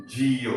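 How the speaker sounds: "जियो" said as one smooth word, jee-yo; "जियो" pronounced jee-yo, not letter by letter; model pronunciation. Shown as a demonstration. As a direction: say "जियो" cleanly, jee-yo, like the answer. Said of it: "तो क्या पढ़ेंगे?".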